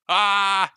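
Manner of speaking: short loud cry of alarm; startled yelp. Real, not acted. GM yelping on realizing the stream is live. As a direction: yelp in sudden panic.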